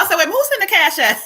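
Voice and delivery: high-pitched mock alarm; retold surprise. Host retelling spotting a cash payment, retold surprise. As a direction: exclaim it as a startled double-take.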